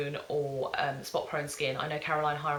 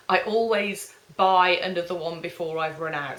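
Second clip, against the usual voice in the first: ordinary talk, nothing marked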